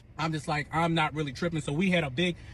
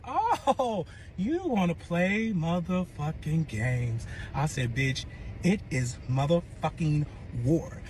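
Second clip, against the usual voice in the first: menacing threat; much slower than usual. Retold or imitated. To her ex, a slow deliberate threat of war. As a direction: spell out the war declaration slowly.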